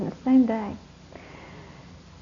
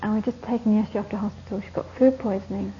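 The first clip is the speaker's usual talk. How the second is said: ordinary talk, nothing marked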